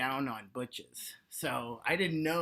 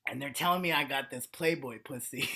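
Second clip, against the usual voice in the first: normal talking voice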